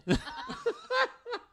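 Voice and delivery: a high, loud burst of laughter; real helpless laugh at the dark joke. Real, not acted. Reacts to grim joke.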